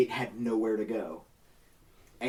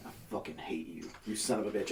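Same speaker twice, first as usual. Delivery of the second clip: grumbling under breath; 2 semitones lower, 8 dB quieter, faster than usual. Retold or imitated. Coworker Jerry cursing the narrator while mopping milk, muttered under his breath. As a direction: mutter it to yourself over the mop.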